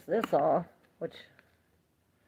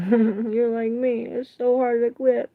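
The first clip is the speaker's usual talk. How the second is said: laughing; 6 semitones higher, 10 dB louder than usual. Real, not acted. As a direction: say it again through a real laugh. Laughing repeat of own words.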